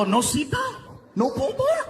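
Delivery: rising, high, thrilled questions; delighted disbelief. Retold or imitated. Big man hearing ride has no bars, giddy disbelief.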